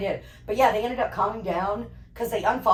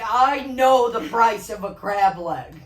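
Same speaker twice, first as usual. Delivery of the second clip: mock indignant; 2 semitones higher, 7 dB louder, slower than usual. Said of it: "He's like".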